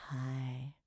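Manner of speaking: soft unvoiced whispered hi. Real, not acted. Streamer whispers hi to her dad off-mic. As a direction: whisper a greeting so you are not heard. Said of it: "Chat, quiet".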